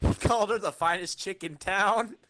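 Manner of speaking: ordinary talk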